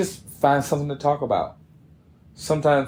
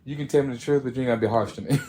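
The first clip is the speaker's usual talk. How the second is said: normal talking voice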